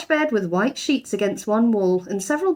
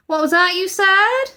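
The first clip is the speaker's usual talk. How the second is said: loud, high sharp demand; sharp housekeeper; 12 semitones higher, 6 dB louder than usual. A narrator's reading. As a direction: catch him out sternly. Mrs Bird rounds on him.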